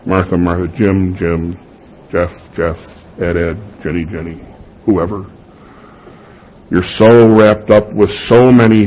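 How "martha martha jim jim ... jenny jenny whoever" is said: tender. Acted. Pastor echoes Jesus' tender address.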